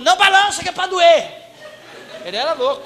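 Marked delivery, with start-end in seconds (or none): 0.0-1.3 high, loud mock pastor rant, laughing through it; pastor shouting. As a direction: bark it like a crazed pastor. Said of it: "ele falou". (pastor gritando, meio rindo)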